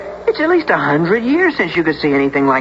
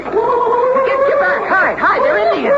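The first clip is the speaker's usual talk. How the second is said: very high, frantic call to hide; high panicked shout with a nervous laugh; panicked warning cry; slower than usual. Acted. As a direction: yell in alarm, voice cracking high. Sees riders, calls to hide.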